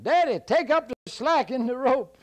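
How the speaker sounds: very high, loud shout; high shouted taunt; hollering. Acted. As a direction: holler across to the giant. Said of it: "Jack hollered out".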